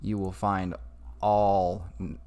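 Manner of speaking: ordinary talk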